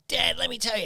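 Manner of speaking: put-on a teen bursting in to tell dad; excited boy. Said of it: "and he goes".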